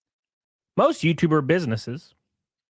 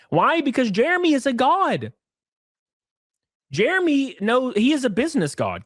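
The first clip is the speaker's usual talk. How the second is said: sarcastic praise; 11 semitones higher than usual. Retold or imitated. Mock praise of Jeremy.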